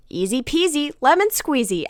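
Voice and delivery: a chirpy robotic catchphrase; programmed phrase. Retold or imitated. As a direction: blurt it like a talking bear. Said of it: "and I immediately say".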